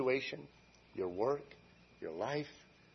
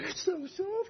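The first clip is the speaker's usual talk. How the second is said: very high, hammed-up squeal of delight; giddy delight; slower than usual. Healed Naaman feeling his new skin, giddy squeal. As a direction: squeak it out in overjoyed wonder.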